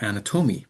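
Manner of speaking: "anatomy" stressed on the third syllable, "ana-TOH-mee"; misplaced word stress. Retold or imitated. Teacher retelling a student's word, stress wrongly on the third syllable. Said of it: "she wasn't trying to say anatomy".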